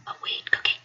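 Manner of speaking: unvoiced, gossipy hush; mock scandalized whisper. Roommate spreading the story, whispered.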